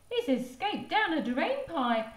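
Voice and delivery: put-on high toddler voice; baby voice. Baby guessing where it went, high toddler voice. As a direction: say it like a little child.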